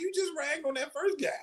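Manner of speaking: calling out the comic in retold disbelief; incredulous reproach. Protesting the mean comic's treatment of the first guy, incredulous. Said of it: "I'm like".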